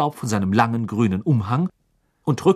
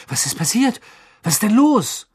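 high-pitched panic, louder on the second question; alarmed questions; 11 semitones higher, 5 dB louder than usual. Acted. Boy hears the panic in the house, alarmed. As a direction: ask in rising alarm.

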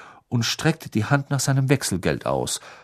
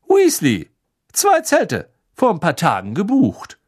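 high, loud, keen answer; too eager to sound normal; eager reply; 9 semitones higher, 7 dB louder than usual. A narrator's reading. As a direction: Mr Weasley keenly answers the Muggle. Arthur gives his booking eagerly.

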